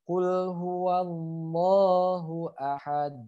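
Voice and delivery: slow recitation, "ahad" ending in a released D; bounced final D. Shown as a demonstration. Teacher models the full verse, slow with bounced D. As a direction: hold "huwallahu" long and bounce the D of "ahad". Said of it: "Sekali lagi saya praktekkan bacanya".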